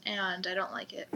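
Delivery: whispered aside, unvoiced; whispering. Real, not acted. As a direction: breathe it as a secret.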